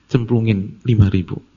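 usual speaking voice

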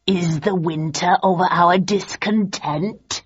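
slow, theatrical villain; Richard's cruel reply. A narrator's reading. Richard answering the audience, slow and cruel. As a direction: relish each word with a cruel smile. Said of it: "replied Richard with a cruel smile".

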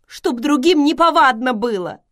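high, loud, upset wail; distressed outcry on the verge of tears; nearly crying. A narrator's reading. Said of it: "чуть не плача сказала Селия".

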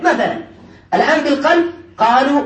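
normal talking voice

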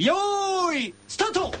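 starter call. Real, not acted. Starting the race.

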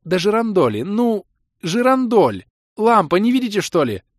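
put-on high, loud, exasperated girl; indignant girl. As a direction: insist it is obvious, stretching the lamp word. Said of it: "Вознегодовала Кэлен".

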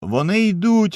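high, loud warning cry; panicked call from horseback; alarmed shout. A narrator's reading. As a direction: raise the alarm to the crowd. Rider warns of approaching enemy.

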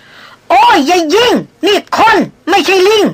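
put-on high, slowed, frantic cry of an old hunter begging not to be shot; panicked yelling. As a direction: shriek it out in a stammering panic. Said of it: "เสียงร้องโวยวายละล่ำละลัก". (ร้องโวยวายละล่ำละลัก เสียงสูงตื่นตระหนก)

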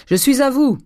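high, loud shout to guests; cried out. A narrator's reading. Master calling to friends he heard arrive, shouted. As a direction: call out that you are on your way. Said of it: "cria Grandet".

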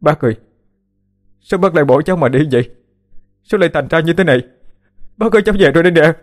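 anguished wailing. A narrator's reading. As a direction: wail in disbelief over a dead uncle. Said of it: "miệng gào lên". (gào khóc đau đớn, giọng cao vút)

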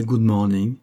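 put-on cheerful good morning, French accent; slow, French-sounding English greeting. A narrator's reading. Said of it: "prononcés à la française".

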